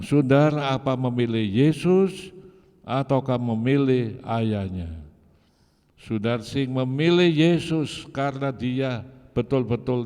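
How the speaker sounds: high, loud preaching climax; fervent preaching. Acted. Preacher challenges the congregation to choose Jesus, loud and high. Preacher rises to full force.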